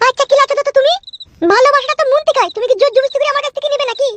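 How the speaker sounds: high-pitched, outraged woman character; indignant married woman. Acted. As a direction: rebuff the suitor in a high, scandalised voice. Skit, woman argues with suitor. (চড়া মেয়েলি গলায় ক্ষুব্ধ প্রত্যাখ্যান)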